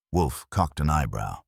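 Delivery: usual speaking voice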